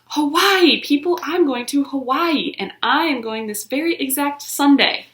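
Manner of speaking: put-on girl hollering her Hawaii news to class. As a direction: holler it with pure excitement. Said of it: "the words came hollering out".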